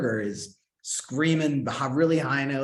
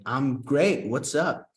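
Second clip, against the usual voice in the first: louder, higher upbeat reply; eager cheer; 5 semitones higher, 6 dB louder, much slower than usual. Retold or imitated. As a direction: sound keen and casual at once. Eager reply to casting agent.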